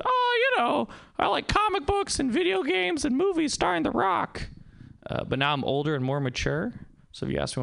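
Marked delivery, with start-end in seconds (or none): 0.0-4.5 put-on high, excited little kid gushing; a young kid listing favorite things. Young boy listing favorite things, high and gushing. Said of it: "I would've said".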